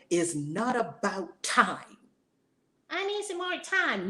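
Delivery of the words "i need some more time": much higher mock whine of an excuse; whiny doubter. Preacher mimicking a believer who stalls, mock whine.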